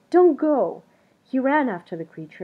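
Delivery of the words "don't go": loud urgent call; crying out. A narrator's reading. Something Else calls after the creature, loud and urgent. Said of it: "he cried".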